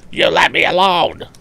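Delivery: high, loud raging bully; almost scream it in baffled rage; near scream. Acted. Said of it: "almost screamed Andy".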